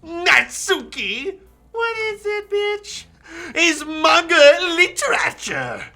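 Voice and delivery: high, slow playful character voices. Acted. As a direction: voice the girls high and bright. Voicing game characters.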